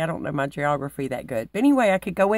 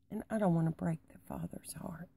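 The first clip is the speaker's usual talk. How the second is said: whispered confession; 9 dB quieter, faster than usual. Real, not acted. Quiet confession of faith.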